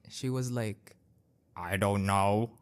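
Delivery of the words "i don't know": low, curt mock reply; rude lady. Imitating the rude lady brushing off the old shop owner. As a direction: toss it off like a rude customer.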